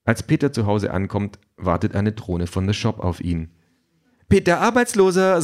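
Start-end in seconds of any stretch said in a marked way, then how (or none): 4.3-5.4 very high, sing-out greeting; cheerful drone. A narrator's reading. Delivery drone greets Peter at home, cheerfully. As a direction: hail him like a chirpy delivery robot. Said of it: "sagt die Drohne fröhlich".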